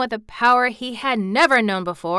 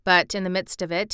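ordinary delivery

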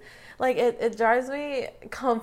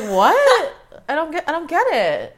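loud, high-pitched disbelief; incredulous; 8 semitones higher, 8 dB louder than usual. Real, not acted. Reacting to absurd question about immigrants, incredulous outburst. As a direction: blurt it out, baffled and loud.

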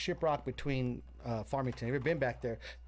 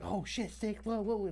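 freaking out; 5 semitones higher, 4 dB quieter than usual. Retold or imitated. Storyteller voicing friends watching the CPR, mild high panic. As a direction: imitate panicked bystanders, pitched up.

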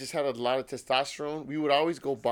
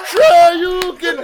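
drawn-out mocking laugh, high and loud; sarcastic; 13 semitones higher, 14 dB louder, much slower than usual. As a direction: scoff it slowly with a laugh. Mocking a friend's claim.

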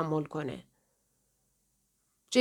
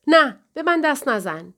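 louder and higher, pushing him away; sharp refusal; 5 semitones higher, 5 dB louder than usual. Acted. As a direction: snap back: don't touch me. Jane pulls away from Michael.

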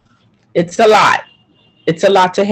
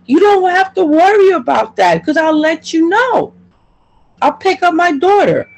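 high, heated plea to the shelter staff; worked-up mother repeating her promises to caseworkers; exasperated pleading; 10 semitones higher than usual. Retold or imitated. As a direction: voice climbing high, pleading to be heard. Quoting her pleas to shelter staff.

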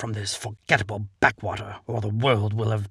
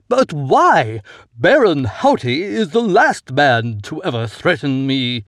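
loud protest; 6 dB louder than usual. A narrator's reading. Count protests loudly.

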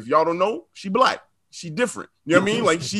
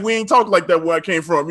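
usual speaking voice

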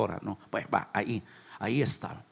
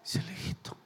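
whispered aside; 4 semitones higher, 11 dB quieter, faster than usual. Acted.

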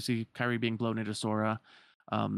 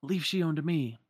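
higher, raised voice of a call; 6 semitones higher, 3 dB louder than usual. Acted. Axel calling to Roxas mid-fight, a raised call. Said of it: "calls out".

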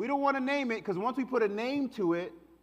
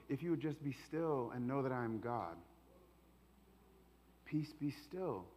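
tender invitation; 9 semitones lower, 15 dB quieter than usual. Acted. Jesus speaking to us each morning, soft tender plea. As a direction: coax them gently into stillness.

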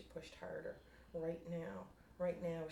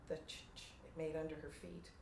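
plain reading voice

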